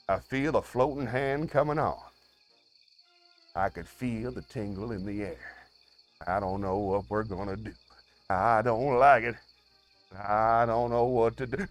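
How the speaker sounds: low, soft, uneasy murmur; hushed dread. Acted. Host feels a floating hand coming, hushed mock dread.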